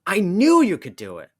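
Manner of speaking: high, loud, thrilled cheer; excited cheer. Acted. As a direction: cheer her on, delighted. Deadpool cheers Maddie's cactus.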